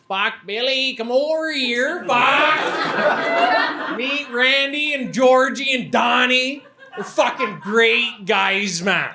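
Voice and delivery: high, slow, hammed-up Alberta accent; exaggerated Alberta hometown-friend voice, pitched high. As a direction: greet your old buddy in a drawn-out Alberta drawl. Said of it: "why don't you sound like he does".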